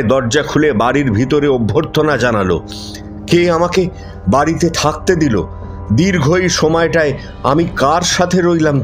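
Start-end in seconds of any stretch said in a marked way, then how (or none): none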